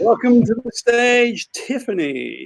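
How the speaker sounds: slow, drawn-out stage introduction; club announcer. Acted. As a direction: start high, then sink on the name.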